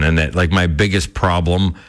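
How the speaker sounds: ordinary talk, nothing marked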